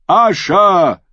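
high, ringing call of a name; echoed cheer. A narrator's reading. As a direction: take up the rallying cry. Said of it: "wiederholte Lord Baelor Blacktide".

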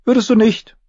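put-on very high, girlish retort; high-pitched defiant little girl; young girl Arya, defiant. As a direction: fire back in a high girl's voice. Voices Arya.

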